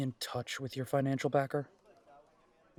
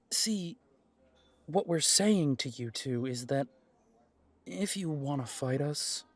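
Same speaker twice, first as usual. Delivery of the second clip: ordinary delivery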